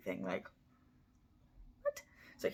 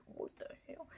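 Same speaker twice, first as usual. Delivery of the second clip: whispered what the hell; 16 dB quieter than usual. Retold or imitated. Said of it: "I would have been like".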